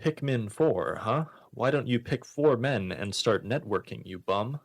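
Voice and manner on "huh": ordinary talk, nothing marked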